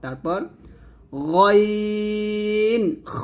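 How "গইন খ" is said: গইন খ stretched slowly from the end of the throat; back-of-throat letters. Shown as a demonstration. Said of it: "খলকের শেষ থেকে উচ্চারণ". (গলার শেষ থেকে গইন খ)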